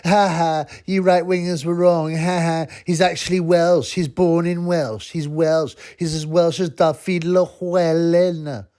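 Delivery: high, slow gloating mock laugh voice; mocking lefties. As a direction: taunt it like a smug leftie, laughing. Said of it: "they're laughing".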